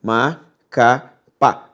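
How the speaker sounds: Macapá split slowly into Ma-ca-pá; teacher dividing Macapá into syllables. Shown as a demonstration. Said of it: "se eu separo silabicamente a palavra Macapá".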